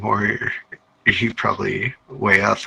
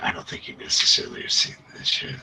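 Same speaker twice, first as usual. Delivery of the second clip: whispering; 9 dB quieter than usual. Real, not acted. DM hides a secret.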